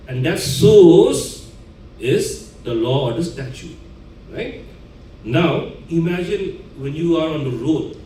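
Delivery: ordinary delivery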